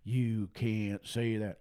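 low, slow sneering imitation; mock censor. Preacher mocking campus censors, low slow sneer. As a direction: drag out the scold.

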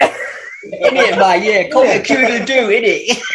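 put-on high comic Cockney accent; laughing, high Cockney bird; Cockney rooster. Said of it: "gotta work that Cockney accent".

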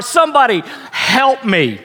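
high preacher's plea, falling at the end; acted cry from the heart; heartfelt cry for help. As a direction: cry out on behalf of the hurting. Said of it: "crying out with all of your heart".

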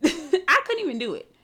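high laughing admission. Real, not acted. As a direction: laugh while confessing. Laughs at failed crybaby voice.

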